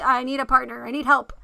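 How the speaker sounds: ordinary talk, nothing marked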